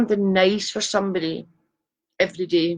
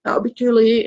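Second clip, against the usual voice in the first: imitating mum; 4 semitones higher, 4 dB louder than usual. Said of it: "and mum used to say".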